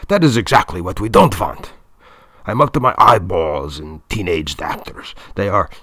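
put-on forceful German-accented complaint; German director, exasperated. A narrator's reading. Director fed up with teenaged actors, exasperated German accent.

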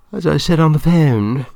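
high, loud voice for Claudia; Claudia, businesslike. A narrator's reading. Film woman introducing her director to Mister Lopat, high and businesslike.

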